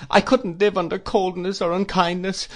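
high, thin-stretched plaintive plea; imploring. A narrator's reading. Mother cannot bear coldness, imploring. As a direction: plead as if near tears.

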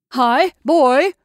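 loud summoning shout. A narrator's reading. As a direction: call down the corridor. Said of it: "shouted Ardalian in the corridor".

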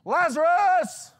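high, long booming shout; loud calling out. Acted. Jesus calls the dead man from the tomb, loud shout. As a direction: call the name out, loud and drawn out. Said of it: "Calls out in a loud voice".

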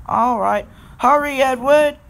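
high, loud urging; urgent shout. Acted. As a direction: shout to hurry him to the rescue. Urgent send-off to the rescue.

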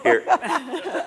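laughing. Real, not acted. Volunteers amid laughter.

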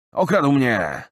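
high, loud indignant outburst. Acted. Robbed man protesting loudly to Tarik, indignant. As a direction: cry out, outraged at the theft. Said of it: "z oburzeniem".

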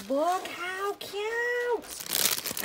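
high, very slow coo over something cute. Real, not acted. Cooing over cute packaging.

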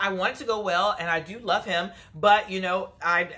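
normal talking voice

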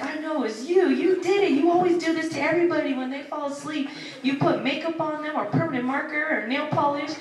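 a father scolding the prankster, hammed up; mock accusation. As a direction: pin the blame on her, half joking. Dad accusing daughter of prank.

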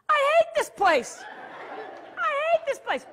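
high-pitched mock tantrum; loud, hammed-up complaint; bratty hollering. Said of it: "that are hollering like me".

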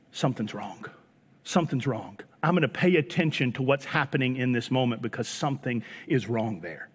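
hushed concern, Joseph in the morning; Joseph's quiet worry. Retold or imitated. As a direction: say it low, sensing danger. Joseph voiced, grave.

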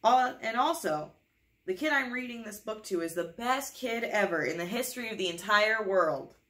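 playful over-the-top praise; excited praise. Acted. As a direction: hype up the kid like a game show host. Book praising the listening kid.

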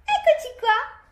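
very high, singsong call to a little one. Real, not acted. Mother greeting her child on arrival, high-pitched calling.

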